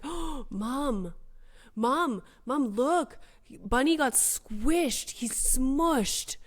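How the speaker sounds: put-on high kid voice, insistent and amazed; imitated excited child. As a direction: mimic a young son's wide-eyed discovery, drawing out key words. Mimics young son spotting roadkill.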